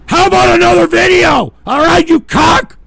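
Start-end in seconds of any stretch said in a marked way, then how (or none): 0.1-1.5 fast, loud, higher sarcastic shout; angry sarcastic yell; sarcastic outburst. Real, not acted. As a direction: hurl the question at them. Host sarcastically erupting at audience.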